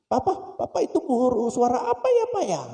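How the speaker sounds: put-on very high childish question; eager child. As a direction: ask like a curious little kid. Small child asking eagerly. (anak kecil bertanya riang)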